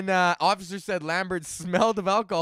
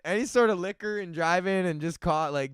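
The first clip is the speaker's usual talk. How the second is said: ordinary talk, nothing marked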